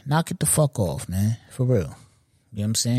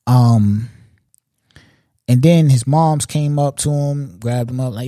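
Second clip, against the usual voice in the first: ordinary talk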